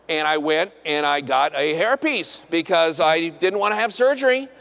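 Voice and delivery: ordinary talk, nothing marked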